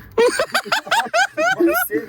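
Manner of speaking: squealing high-pitched laugh. Real, not acted. Laughing at partner spitting on him, high squeal. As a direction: burst into a shrill squeal of a laugh.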